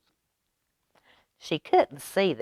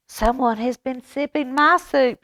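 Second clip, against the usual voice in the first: higher, louder mama bear growl; 9 semitones higher, 5 dB louder than usual. Acted. Mama Bear finding her sloshed soup, growling higher. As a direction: growl it out, indignant. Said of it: "she growled".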